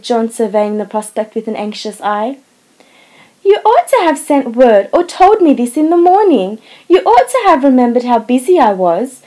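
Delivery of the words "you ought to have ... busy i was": higher, louder, sulky reproach; wife grumbling at her husband, petulant. A narrator's reading. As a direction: pout and complain at him. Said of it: "continued Meg petulantly".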